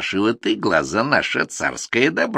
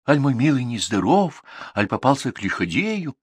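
raised, gentle questioning horse voice; caring little horse; 7 semitones higher than usual. Acted. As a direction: comfort a sad friend. Horse comforts Ivan.

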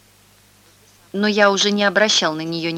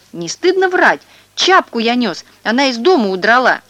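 high, loud outraged cry; boy protesting loudly; indignant shout; 5 semitones higher, 5 dB louder than usual. A narrator's reading. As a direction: throw the lie back at him. Indignant boy answers accusation.

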